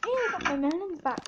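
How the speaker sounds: normal talking voice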